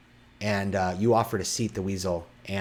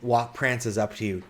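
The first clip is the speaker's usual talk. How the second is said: normal talking voice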